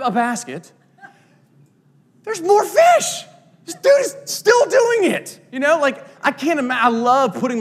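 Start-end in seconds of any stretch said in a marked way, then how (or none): 2.2-5.4 much higher, slow and a bit louder, in awe; amazed onlooker. Pretend. A crowd member at the feeding of five thousand, amazed. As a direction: marvel out loud like someone watching a miracle unfold.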